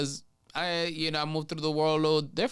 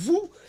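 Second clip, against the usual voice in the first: sharp high whoop as the ice flies; whoop throw sound; 8 dB louder than usual. Retold or imitated. Teller acting out throwing ice at the car, high whoop. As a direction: yelp it out like flinging a cup.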